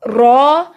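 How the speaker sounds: slow, long-held रॉ; lengthened रॉ. Shown as a demonstration. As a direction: hold the vowel of रॉ at length. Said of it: "लंबा करेंगे".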